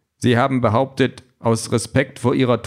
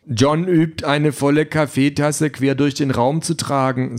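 higher-pitched voice for the trainer; Trainer im Roman; 4 semitones higher, slower than usual. Acted. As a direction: play the coach, pitched up. (Trainerstimme, höher gesprochen)

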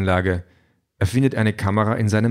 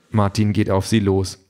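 plain reading voice

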